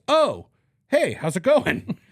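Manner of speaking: high, loud, slow mock-cheery hello; playing the other lawyer's sugary hello; cheery greeting. The lawyer picking up Jeremy's call, mock cheery and high.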